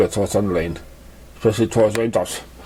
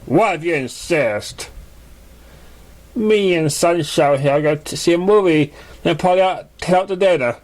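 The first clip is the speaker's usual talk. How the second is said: higher, slightly different voice, casual; lightly voiced stallion; 7 semitones higher than usual. Thunderlane tells Cloud about the date, higher and casual. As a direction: announce the date offhandedly.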